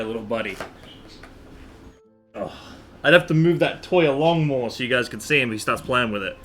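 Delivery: usual speaking voice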